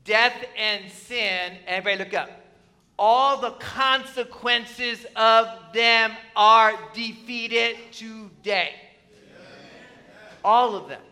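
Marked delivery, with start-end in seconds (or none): 0.0-1.6 "Death and sin" slowly, each word weighted; emphatic. Real, not acted. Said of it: "say this with emphasis". 3.0-8.7 slow, loud proclamation, word by word; emphatic. Real, not acted. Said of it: "say this with emphasis".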